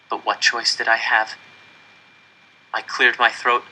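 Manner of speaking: ordinary delivery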